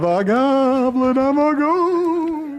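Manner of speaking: very high, slow, overdone goblin voice; over-the-top goblin. Shown as a demonstration. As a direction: stretch goblin out high and silly. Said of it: "terrible over the top impression".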